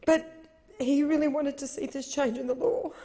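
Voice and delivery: ordinary talk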